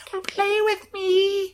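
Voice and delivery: put-on high, slow, eerie child voice; drawn-out spooky kid invitation; creepy child. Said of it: "creepy kid voice".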